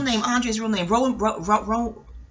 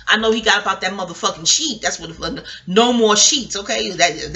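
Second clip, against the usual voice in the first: ordinary talk, nothing marked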